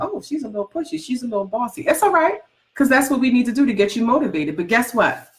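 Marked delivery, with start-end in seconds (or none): 0.0-1.8 mock complaining client. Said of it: "sometimes people are like".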